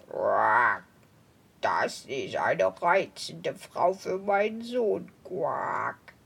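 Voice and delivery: very low, slow croaking toad. Acted.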